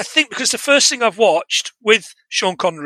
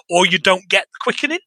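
usual speaking voice

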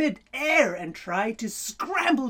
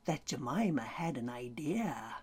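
whispering; 10 semitones lower, 12 dB quieter than usual. A narrator's reading. Storyteller drops to a whisper.

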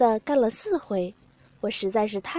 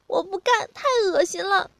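high-pitched voice of repulsed refusal; disgusted refusal; 8 semitones higher than usual. A narrator's reading. Partner refuses the narrator's order, disgusted refusal.

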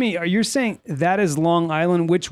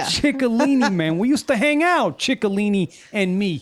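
put-on Italian New York accent; 4 semitones higher than usual. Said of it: "So Italian".

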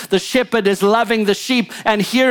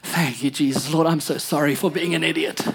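much softer, lower, humbled prayer; mock tearful repentance; 7 semitones lower, 6 dB quieter than usual. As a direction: melt into a sorry little prayer. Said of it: "all of a sudden".

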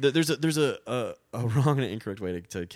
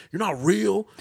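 much higher and louder, hammed-up; mocking a would-be tough guy; 12 semitones higher, 8 dB louder, slower than usual. Bartender mocking the heckler, high and loud.

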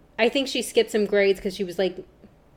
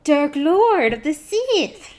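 slow, drawn-out Gungan voice trying to sound sinister; a half-hearted Dark Jar Jar impression; attempted Jar Jar voice; 6 semitones higher, 3 dB louder, much slower than usual. Friend tries a Jar Jar voice on the podcast, slow and drawn-out. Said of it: "Okay, I tried. I can't do it".

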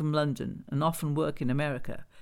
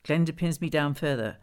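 plain reading voice

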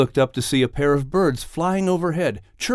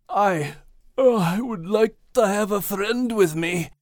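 higher, drowsy Scottish brogue; sleepy yawning Scottish dog; 8 semitones higher than usual. Acted. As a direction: yawn through it, lonely. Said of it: "with a long yawn".

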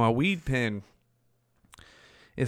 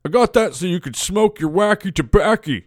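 imitating grandpa; 7 semitones higher than usual. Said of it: "And he said".

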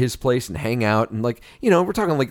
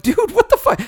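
very high, louder squeal of disbelief; mock outrage; 5 dB louder than usual. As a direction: screech at your buddy in fake horror. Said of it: "And I'm like".

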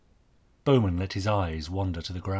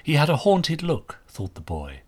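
usual speaking voice

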